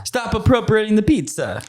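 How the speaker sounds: high, cartoon Italian accent; mock Italian accent. Joking about appropriated culture, mock Italian accent. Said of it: "Super Mario Brothers accent".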